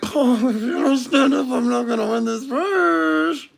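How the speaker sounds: high-pitched, tearful mock whine; exaggerated high pleading; whining plea. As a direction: wail it at your teammate, stretching the last word.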